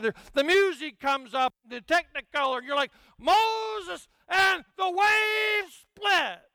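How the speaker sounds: hammed-up movie grandeur ending in a high slow shout; slow, booming parody of a Moses movie moment; mock epic. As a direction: swell like a Technicolor epic. Mocking epic movie scene.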